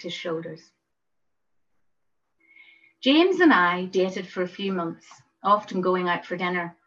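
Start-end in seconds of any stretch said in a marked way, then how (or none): none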